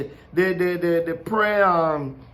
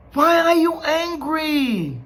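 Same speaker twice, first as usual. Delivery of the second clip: slow, drawn-out, higher-pitched question; questioning God; 5 semitones higher, slower than usual. Acted. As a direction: stretch it out as God questioning Cain. God's question to Cain, voiced again.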